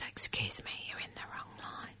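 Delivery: retold confidential whisper. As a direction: whisper it discreetly into someone's ear. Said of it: "she whispers in my ear".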